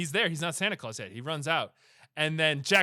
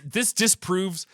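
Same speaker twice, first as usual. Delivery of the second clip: ordinary delivery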